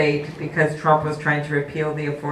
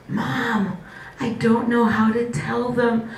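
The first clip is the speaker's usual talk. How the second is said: retold whisper; 7 semitones higher, slower than usual. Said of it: "she whispers at me".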